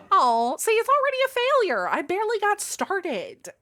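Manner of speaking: put-on high, loud Valley Girl whine of protest; over-the-top Valley Girl, pitch shooting up. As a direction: squeal it like an exasperated Valley Girl. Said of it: "Valley Girl is not something".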